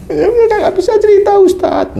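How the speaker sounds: high tearful mock sob of the patient; imitating crying. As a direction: act the man choking up. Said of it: "Dia nangis".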